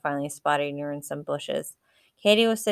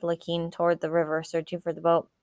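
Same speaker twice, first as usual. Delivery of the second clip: plain reading voice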